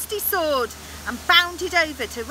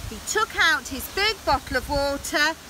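plain reading voice

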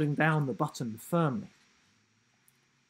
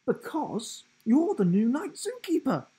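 higher, cheerful giraffe voice; grinning giraffe; 12 semitones higher, 6 dB louder than usual. A narrator's reading. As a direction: say it with a grin. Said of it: "grinning giraffe".

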